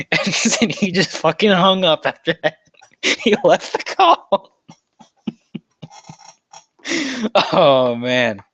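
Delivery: high laughing telling; words bubbling with laughter. Real, not acted.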